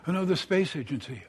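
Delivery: plain reading voice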